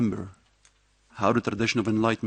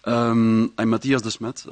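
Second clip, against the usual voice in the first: raised voice to be heard; louder, making sure listeners can hear; speaking louder; 7 dB louder, slower than usual. Real, not acted. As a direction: project the introduction to the room. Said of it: "I will try to speak louder".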